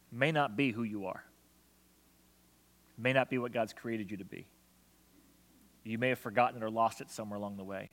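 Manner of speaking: ordinary talk, nothing marked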